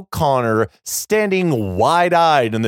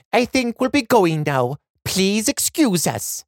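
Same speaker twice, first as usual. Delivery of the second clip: put-on lightly raised girlish voice; polite young girl, voiced higher; 8 semitones higher than usual. A narrator's reading. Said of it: "She turns toward the guards and says".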